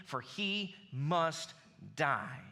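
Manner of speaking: slowed right down, dragging out "for he must"; ominous verdict. Acted. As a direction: spell out the death sentence, one word at a time. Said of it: "Saul's anger flared up at Jonathan".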